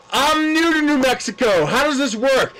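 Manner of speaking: loud, high, laughing mock-naive taunt; loud mock innocence. Loudly taunting the pedestrian in front of the crowd, mock-naive. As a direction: play dumb at full volume for the crowd. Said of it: "And I'm loud at this point".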